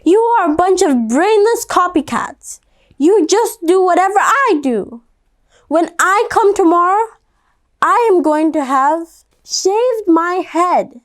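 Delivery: a girl yelling at her copycat classmates, hammed-up anger; angry yell. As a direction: scold them like a fed-up girl. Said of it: "Stephanie yelled".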